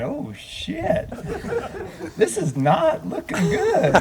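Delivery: high, slow mock dismay through a laugh; embarrassed panic. Minister retelling his caught-bent-over moment, laughing. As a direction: squeak it out, caught red-handed. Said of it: "And I'm going".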